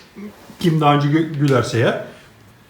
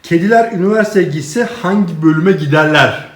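normal talking voice

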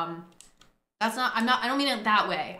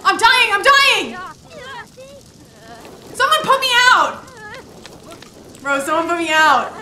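frantic high shrieks for help, much louder; panicked screaming; 10 semitones higher, 13 dB louder than usual. Real, not acted. Gamer yelling as her sim catches fire. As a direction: scream like you're burning alive.

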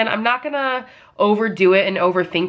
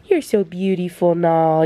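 pet talk to dog. Real, not acted. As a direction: coo it to the dog lying beside you. Praising the dog on the floor.

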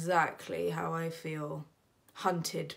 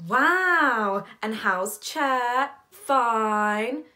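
loud, drawn-out, swooping small talk; stilted phone pleasantries; 5 semitones higher, 9 dB louder, much slower than usual. Acted. Siblings trading dull family updates, exaggerated politeness. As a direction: stretch each empty pleasantry out.

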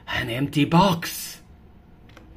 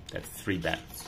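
plain reading voice

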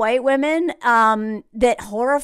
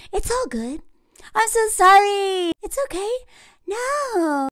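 much higher, sugary mock apology; 9 semitones higher than usual. Mocking Laura apologizing to Sarah, higher sugary voice. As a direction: play a fake-sorry Southern belle, higher.

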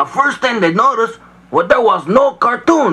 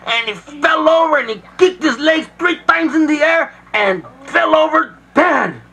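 high climactic narration; excited build; 6 semitones higher than usual. A narrator's reading. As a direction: build to the death.

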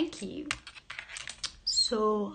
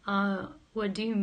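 ordinary delivery

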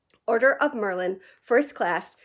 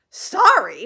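sharp, loud, disbelieving bark; 4 semitones higher, 7 dB louder than usual. A narrator's reading. Hagrid barking in disbelief at Harry's apology. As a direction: snap the word back at him. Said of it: "barked Hagrid".

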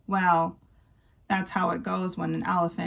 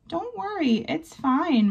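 higher, squeaking little mouse voice, kindly; squeaky mouse; 8 semitones higher than usual. Acted. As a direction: squeak it out like a small friendly mouse. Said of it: "Marvin squeaks".

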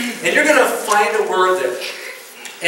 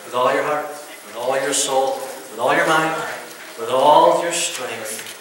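slow, low, reverent reading of the commandment; solemn scripture recitation; 6 semitones lower, 4 dB quieter than usual. Acted. Preacher reciting Jesus's greatest commandment, slow and solemn. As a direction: intone each line slowly like a creed.

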